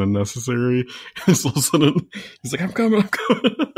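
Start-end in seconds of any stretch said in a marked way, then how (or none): 2.6-3.7 high-pitched imitation of someone calling out; playful put-on urgent voice; mock hurried call. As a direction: call it high, as if rushing over. Imitates hero rushing after the moment.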